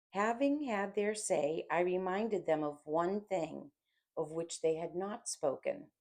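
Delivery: plain reading voice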